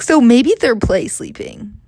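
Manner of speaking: ordinary talk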